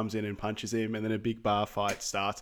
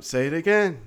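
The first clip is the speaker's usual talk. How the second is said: louder, higher push to repeat; urging command; 6 semitones higher, 6 dB louder than usual. Retold or imitated. Coach firing up Junior at the mirror, louder urging. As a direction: demand it again, firing him up.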